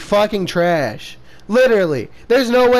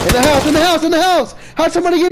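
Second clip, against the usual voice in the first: high, rising urgent call; urgent callout; 9 semitones higher, much faster than usual. Real, not acted. Calling out enemy position.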